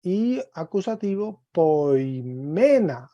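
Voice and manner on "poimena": poimena drawn out slowly, "me" pushed up; exaggerated stress on ME. Shown as a demonstration. Teacher marking the accent of the accusative, heavy stress on "me" in poimena. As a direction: load the accent onto "me" in poimena. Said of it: "Estoy señalando, recargando como el acento".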